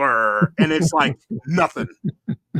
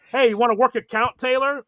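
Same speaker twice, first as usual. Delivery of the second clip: higher and louder, calling out to the batter; mock heckle from the stands; 8 semitones higher, 5 dB louder than usual. Fan heckling Taylor from the stands, in a loud mock yell. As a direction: yell it across the ballpark at him.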